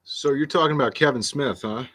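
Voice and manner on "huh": ordinary talk, nothing marked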